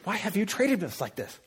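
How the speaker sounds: high, strained scolding half-whisper; stern mom whisper. Acted. Mother to son at church, stern strained whisper. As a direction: scold the child without making a scene. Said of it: "how moms are at stern at church".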